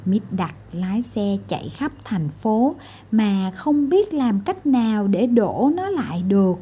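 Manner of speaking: usual speaking voice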